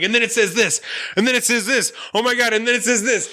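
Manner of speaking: rising, hammed-up excitement; mock excited reading. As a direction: read it out like it's breaking news. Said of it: "I'm like".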